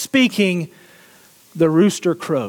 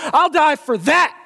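fervent vow; 10 semitones higher than usual. Acted. Jesus voiced in the sermon.

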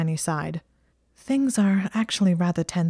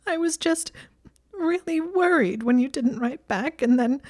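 high-pitched, tearful acted sobbing; crying; 9 semitones higher than usual. Hermione telling friends how worried she was, crying. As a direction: choke out the worry in a high weepy voice. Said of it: "failing as they kept coming".

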